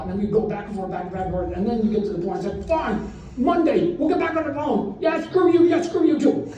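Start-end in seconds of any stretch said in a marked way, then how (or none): 2.7-6.5 high, heated phone-fight shout; mock yelling. Two sides ending a lawyer phone fight, mock angry yelling. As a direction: act out a pitched-up angry sign-off. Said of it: "I'm yelling at a lawyer".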